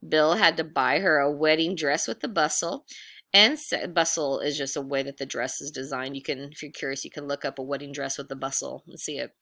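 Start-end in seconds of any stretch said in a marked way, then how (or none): none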